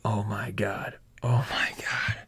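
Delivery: breathless unvoiced whisper; stunned little whisper to himself. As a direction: mutter it under your breath in disbelief. Thor overwhelmed, whispering to himself.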